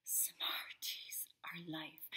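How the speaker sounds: slow, low reverent whisper; hushed, slow candy devotion. Real, not acted. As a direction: whisper it slowly like a confession.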